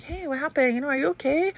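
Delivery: high, soft mom voice; retold gentle concern. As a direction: gently check on an upset little one. Retold checking on upset son.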